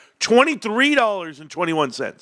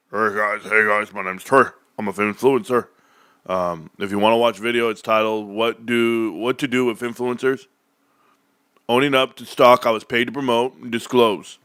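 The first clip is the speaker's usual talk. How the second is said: much lower, droning mock-influencer voice; mocking Trey impression; 8 semitones lower than usual. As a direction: play a dull influencer reading his own intro, low and flat. Mocking Trey's influencer video intro.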